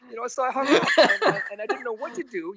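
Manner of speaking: words breaking into laughter; real laughter over the memory; laughing. Real, not acted. Laughing at his own story.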